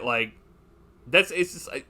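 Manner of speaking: ordinary talk, nothing marked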